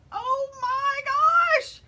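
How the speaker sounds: high, loud, drawn-out cry of delight; excited squeal. Real, not acted. Knitter gushing over her pom-pom hat, thrilled squeal. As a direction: squeal it out, stretching each word.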